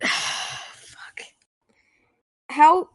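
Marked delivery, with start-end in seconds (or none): 0.0-1.2 breathy exasperated whisper; whispered sigh. Real, not acted. Stuck for words, exasperated.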